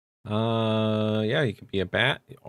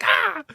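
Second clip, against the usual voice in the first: bird screech. Retold or imitated. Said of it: "She's like".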